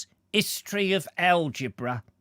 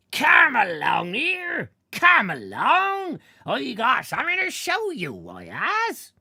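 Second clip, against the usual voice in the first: hoarse clown shout; 11 semitones higher, 5 dB louder than usual. Acted. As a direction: bellow like a fairground clown calling the crowd. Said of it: "He shouted in a voice already hoarse".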